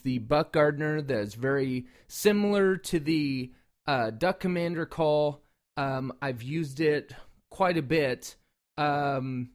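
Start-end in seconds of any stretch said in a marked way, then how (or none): none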